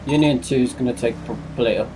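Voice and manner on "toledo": ordinary talk, nothing marked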